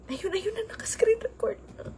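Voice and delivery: soft relieved whisper; hushed aside. Real, not acted. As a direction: whisper so as not to break the moment. Hushed aside while recording.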